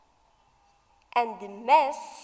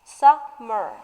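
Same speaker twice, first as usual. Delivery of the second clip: "summer" slowly split as sum, mer; syllable by syllable; 2 semitones lower, 4 dB louder than usual. Shown as a demonstration. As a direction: break "summer" into two beats with a gap. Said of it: "So it has two syllables".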